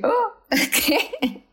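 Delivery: high, laughing "oh, what?"; recalling spotting the release year, laughing; mock surprise. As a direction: squeal of disbelief, laughing.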